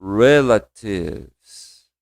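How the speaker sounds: Relatives very slow, dropping the middle E, rel-tives; slow pronunciation demo. Shown as a demonstration. Teacher shows class Relatives without the E, very slow. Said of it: "Ficava assim, ó".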